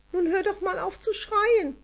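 a high little elf scolding the screamer; tiny voice. A narrator's reading. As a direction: speak like a tiny winged fairy. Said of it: "sagte eine winzige Stimme".

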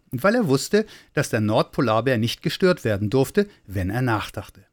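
usual speaking voice

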